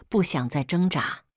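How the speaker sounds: usual speaking voice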